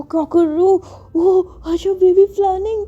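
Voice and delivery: put-on giggly little-girl voice; childish squeal. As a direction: squeal in silly surprise. Maya reacts as undressed.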